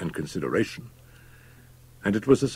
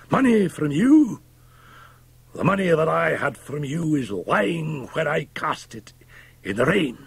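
high, ringing scorn; indignant cry; 10 semitones higher than usual. A narrator's reading. Fettes refusing money from the doctor, scornful cry. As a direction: spit back the offer at high pitch. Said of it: "cried Fettes".

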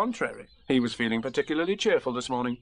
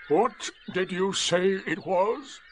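slow, higher, ponderous donkey voice; Eeyore; 7 semitones higher, much slower than usual. A narrator's reading. Donkey inspecting the newcomer, slow and doubtful. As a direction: draw the question out dubiously. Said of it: "he asked".

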